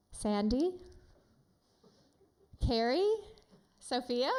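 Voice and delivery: loud, upbeat calls to the room; loud, cheerful roll-call; calling out names. Real, not acted. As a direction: call on people to guess. Calling on audience members.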